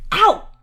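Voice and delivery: a sharp, high, loud yelp of pain. Acted. Solix yelping when his hand is zapped. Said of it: "Solix yelps".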